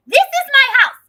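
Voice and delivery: high, loud, emphatic tirade; acted-out furious telling-off; in-her-face confrontation. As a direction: square up and yell each declaration. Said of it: "I get in her face".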